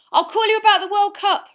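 a high, loud call across the platform; yelling. A narrator's reading. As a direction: yell it after a departing friend. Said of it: "Ron yelled after Harry".

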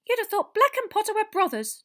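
very high, loud piping voice; squeaky, excitable little teacher; Professor Flitwick. A narrator's reading. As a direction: chime in like a tiny squeaky professor. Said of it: "chimed in Professor Flitwick".

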